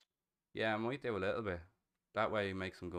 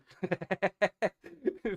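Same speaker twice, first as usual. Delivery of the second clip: big laugh; 12 semitones higher, 8 dB louder than usual. Real, not acted. Laughing at chat's comment.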